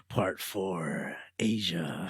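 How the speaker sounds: soft, low whisper; mock whispered title. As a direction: whisper it like a sultry film title. Mock soundtrack title announcement.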